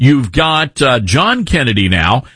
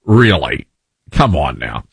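exasperated; 5 semitones lower than usual. Retold or imitated. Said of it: "you're like".

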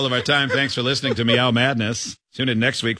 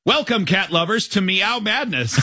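upbeat, higher radio announcer; radio host voice; 9 semitones higher than usual. Acted. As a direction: sell it like a morning DJ. Reads AI radio host script.

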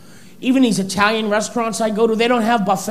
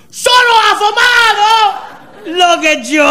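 put-on much higher, louder, surprised Italian-accent echo; delighted Italian waiter; 11 semitones higher, 4 dB louder, slower than usual. Italian waiter amazed at the customer's phrase, higher and louder. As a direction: echo it back, thrilled and amazed. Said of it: "He went".

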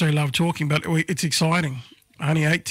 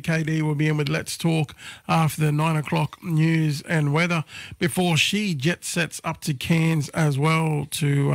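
usual speaking voice

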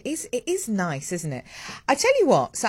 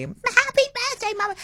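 put-on much higher, light child's cheer; imitating excited kids. Mother imitating her kids' happy birthday cheer. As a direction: chirp it like an eager child.

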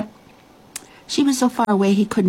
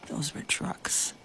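very soft, slow near-whisper; hushed aside; 2 semitones lower, 14 dB quieter, slower than usual. A narrator's reading. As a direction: drop it to a confidential murmur. Hushed aside explaining the story.

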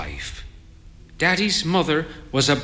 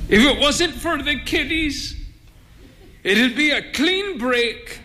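high-pitched lament; high, put-upon character voice; mildly exasperated man; 12 semitones higher than usual. A narrator's reading. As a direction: complain in a much raised pitch. Exasperated man continues his outcry.

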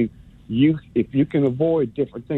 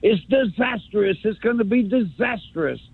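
mock doom pundit; 7 semitones higher than usual. Mocking a TV analyst predicting disaster, hammed up. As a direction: wail it like the sky is falling.

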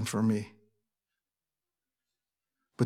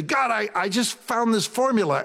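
proud, higher voice announcing a find; eager discovery; 10 semitones higher than usual. Pretend. Believer telling God a discovery, proudly excited. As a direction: show off your clever idea to God. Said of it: "You say".

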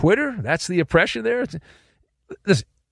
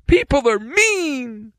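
high, drawn-out cartoonish complaint; mock victim voice; 8 semitones higher, slower than usual. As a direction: mimic a self-pitying complainer up high. Mocking the complainers' voice once more.

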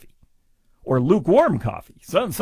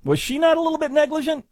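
incredulous question; 12 semitones higher, faster than usual. Real, not acted. Incredulous rhetorical question to audience.

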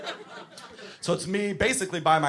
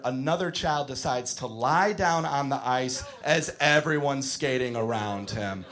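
normal talking voice

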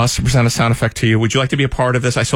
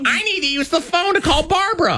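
mom voice. Retold or imitated. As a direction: play his mom, pitched way up. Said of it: "and then my mom".

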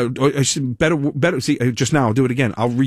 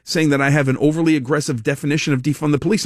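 ordinary delivery

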